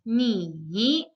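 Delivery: "ni" slow, dipping then rising; third tone. Shown as a demonstration. Teacher shows the word for you, "ni" in a slow dip. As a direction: draw out "ni" down and back up. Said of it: "pronounced in the third tone".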